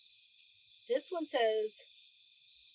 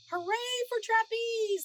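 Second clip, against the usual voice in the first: shouted cheer; 12 semitones higher, 5 dB louder than usual. A narrator's reading. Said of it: "they shouted".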